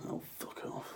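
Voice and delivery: muttered under the breath; whispered, cringing at himself; whispered self-rebuke. Real, not acted. Cringing at his own word.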